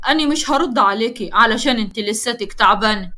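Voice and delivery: plain reading voice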